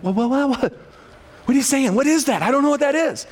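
high, baffled, stammering what-what; confused listener. Retold or imitated. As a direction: play the lost listener who can't follow. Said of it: "you're like".

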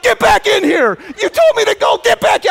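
high, loud retold scolding; furious shout. Wife yelling at husband to return, high angry shout. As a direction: order him back, exasperated. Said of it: "she's like".

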